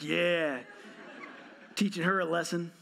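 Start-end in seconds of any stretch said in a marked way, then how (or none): 0.0-0.6 laughing dad. Retold or imitated. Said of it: "Every dad's like".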